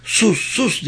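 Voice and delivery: high-pitched restrained yell; mock a shout without much volume. A narrator's reading. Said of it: "diye bağırdı Penelope".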